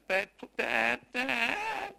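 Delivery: put-on pestering child, last word drawn out high; whiny child. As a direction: whine for Dad, pitch rising on each call. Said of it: "whiny voice that goes up higher and higher".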